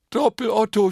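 high, loud, overjoyed cry; freudiger Schrei. A narrator's reading. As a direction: shout the name with delight. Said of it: "schrie er voller Freude". (voller Freude laut geschrien)

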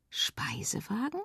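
soft unvoiced wondering question; whispered thought. Girl privately repeats the mother's word, whispered as a question. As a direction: whisper it to herself, curious. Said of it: "dachte Feli".

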